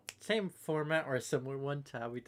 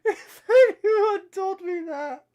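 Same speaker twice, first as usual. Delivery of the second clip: laughing; 15 dB louder, slower than usual. Real, not acted. Laughing while reading post.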